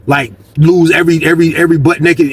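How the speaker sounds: ordinary talk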